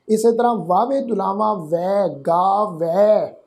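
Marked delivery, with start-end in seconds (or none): 1.5-3.3 ਗਾ ਵੈ very slowly, with ਵੈ wide open; open ai vowel. Shown as a demonstration. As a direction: stretch ਵੈ with a fully opened mouth. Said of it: "ਪੂਰੀ ਤਰ੍ਹਾਂ ਖੋਲ੍ਹ ਕੇ ਉਚਾਰਨ". (ਗਾ ਵੈ ਹੌਲੀ, ਪੂਰਾ ਮੂੰਹ ਖੋਲ੍ਹ ਕੇ)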